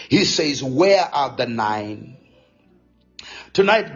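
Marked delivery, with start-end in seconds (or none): 0.6-2.2 low, slow, solemn question; quoting Jesus. Retold or imitated. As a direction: draw the question out gravely, lightly in role.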